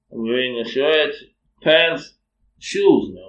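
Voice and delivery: acted incredulous answer, punching each item; indignant comeback.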